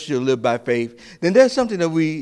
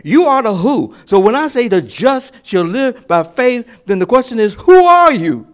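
louder, higher preaching, building to a cry on the question; preaching at full force; 7 semitones higher, 8 dB louder than usual. Acted. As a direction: preach it, climbing to a shout.

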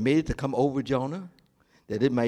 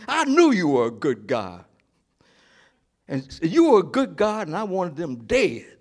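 Jonah's complaint; 5 dB louder than usual. Acted.

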